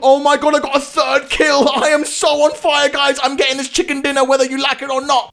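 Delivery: high-pitched excited boast; elated bragging. Real, not acted. Player to viewers after third kill, elated bragging. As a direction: crow about it, voice riding high.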